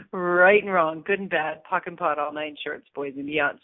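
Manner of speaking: low, quick incantation; clearing statement recital. Acted. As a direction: rattle it off like a spell.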